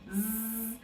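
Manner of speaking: a long buzzing zzz like a bee; sustained voiced z sound. Shown as a demonstration. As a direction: hum the z like a bee. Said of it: "A abelha faz zzz".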